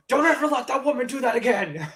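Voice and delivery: high hammed-up monster; imitating Carnage. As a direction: scold in a put-on voice. Carnage voiced, retold.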